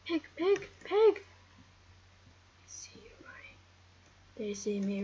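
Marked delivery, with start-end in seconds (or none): 0.1-1.2 much higher and louder with each pig; rising excited shout at the pig; excited calling out. Real, not acted. 1.2-3.6 slow, breathy whisper; slow stalking whisper to the pig; creepy whisper. Pretend.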